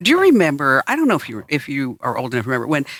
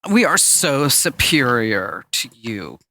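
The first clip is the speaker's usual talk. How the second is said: smug superior; 3 semitones lower, much slower than usual. Retold or imitated. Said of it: "the Apple would be like".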